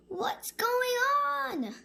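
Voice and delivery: high, slow puzzled question; confused monkey voice. Acted. Monkey waking up confused about everything. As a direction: wonder aloud, baffled.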